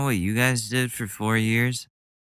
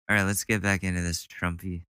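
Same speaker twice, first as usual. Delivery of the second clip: ordinary talk, nothing marked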